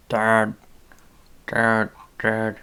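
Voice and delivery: loud call across the house; calling dad. Real, not acted. Podcaster calling to his dad mid-recording, loud call. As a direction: shout for your dad from another room.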